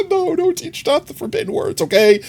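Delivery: high squeaky scolding; high-pitched mock outrage; mock protest.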